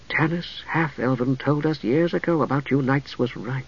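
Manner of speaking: half to herself, barely aloud; murmured. A narrator's reading. Priestess musing on the dark knight, murmuring. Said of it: "she murmured".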